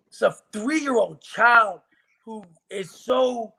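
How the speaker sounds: normal talking voice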